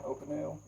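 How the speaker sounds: a careful, unsure "Opinel." said OH-puh-nil; careful pronunciation. Shown as a demonstration. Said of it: "Hope I'm saying it right".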